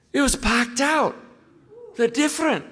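imitating the Glaswegian pastor's soaring excitement about his church; thrilled Barry. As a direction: climb up into a giddy, breathless report. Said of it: "he said".

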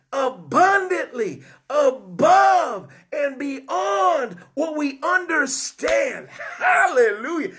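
preacher shouting praises to the congregation; full-force preaching. Real, not acted. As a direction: build the crowd up, proclaiming.

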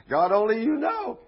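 high, loud acted cry to God; Ezekiel's exclamation. Ezekiel answering God about the bones, overwhelmed. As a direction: throw it upward, loud and high.

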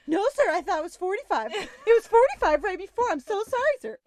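high, loud, laughing nervous pleading; nervous apology. Retold or imitated. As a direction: babble an excuse with the voice way up. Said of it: "like, so nervous".

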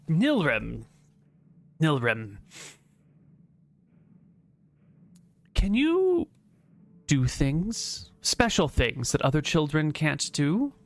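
lightly voiced Inquisitor with long pauses; measured, questioning authority figure. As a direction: weigh the name, then probe the child carefully.

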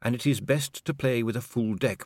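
hesitant pedant correcting a superior; official, tactfully correcting. Acted. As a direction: explain protocol with careful deference. Voices Inigo, fussy official.

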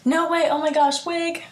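dramatic, wig-snatched disbelief; shocked. Shown as a demonstration. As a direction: act out hearing jaw-dropping gossip. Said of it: "Like, shocked".

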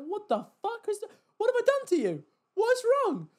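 very high, incredulous squeal; rising to loud, high-pitched exasperation; bewildered outrage. Retold or imitated. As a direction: protest in baffled, mock-wounded disbelief. Acts out bewildered reaction to rudeness.

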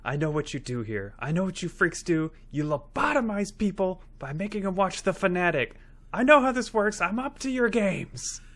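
rising from a mutter to a mock shout; hammed-up paranoid rant growing to a yell; building paranoid outburst. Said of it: "And scene".

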